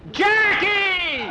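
very high, loud cry; high-pitched excited yell; screaming. A narrator's reading. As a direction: shriek the name out, drawn out. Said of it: "had heard Skeeter screaming".

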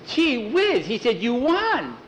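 put-on high, thrilled kid voice; amazed child. Excited child tells Jackie of win.

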